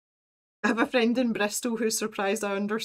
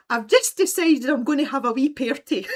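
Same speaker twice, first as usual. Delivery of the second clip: imitating her rural Scottish dialect, higher and louder; friend's country dialect; 6 semitones higher, 8 dB louder than usual. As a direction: quote her in a broad country Scottish accent, loud and bright. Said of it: "she spoke like that all the time".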